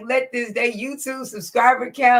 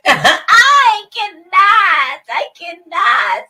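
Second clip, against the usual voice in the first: helpless laughter; 5 semitones higher, 5 dB louder, much slower than usual. Real, not acted. Cracking up at the clip.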